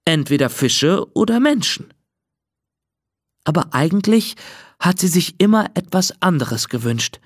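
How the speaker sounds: ordinary delivery, nothing marked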